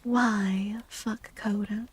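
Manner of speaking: ordinary talk, nothing marked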